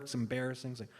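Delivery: ordinary delivery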